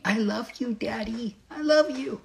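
put-on tiny, high, soft toddler voice; little angel daughter. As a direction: imitate a little girl sweetly telling her dad. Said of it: "this little angel wanting to hug me".

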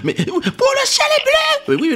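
put-on exasperated boyfriend hamming up a desperate plea; mock exasperated yell. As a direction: wail the obvious point in a squeaky put-on voice. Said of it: "tu lui dis des".

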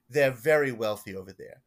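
put-on emphatic old schemer, thick Long Island accent; Gordon's Long Island accent. Parishioner sending his pastor to the priest, Long Island accent. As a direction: imitate an insistent Long Island eccentric. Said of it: "and this is how he talks".

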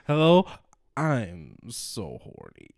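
slow, low, drawn-out horny hello; mock sultry caller. Mocking a horny caller on a dating site, slow and low. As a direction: purr it slowly like a lonely caller. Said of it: "mocking a horny caller".

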